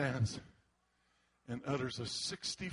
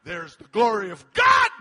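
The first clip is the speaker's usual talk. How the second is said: triumphant proclamation; 7 semitones higher, 16 dB louder than usual. Real, not acted. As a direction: rise to a loud, high shout. Preacher proclaims the climax.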